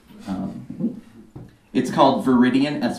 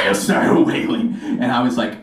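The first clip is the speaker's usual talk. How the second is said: ordinary delivery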